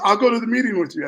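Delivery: high, loud and fast okay; eager agreement. Retold or imitated. Best friend agreeing to join the meeting, fast, high and loud. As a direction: rush it out, keen to come along. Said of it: "So he goes".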